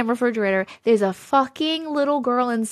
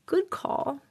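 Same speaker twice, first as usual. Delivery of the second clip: usual speaking voice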